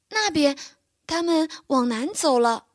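high, small fawn voice. A narrator's reading. Lost fawn points the way south for the bear, high and small. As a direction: answer simply like a small child. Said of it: "奥利弗回答道".

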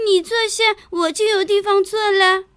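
high, small secretive voice; a tiny ant sharing a secret; sly little ant. Acted. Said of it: "小蚂蚁神秘地眨眨眼睛说".

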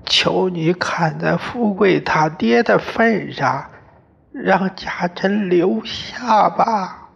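mother begging the father-in-law. Acted. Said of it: "弯下去求他".

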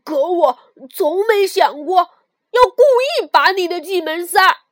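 loud, fast indignant shout; angry. Acted. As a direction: shout back, deeply offended. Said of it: "跳跳也发怒了".

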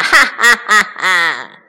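slow, loud evil cackle; drawn-out gloating "ha ha ha"; weird villain laugh. A narrator's reading. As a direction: laugh like a triumphant bandit chief. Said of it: "怪声笑道".

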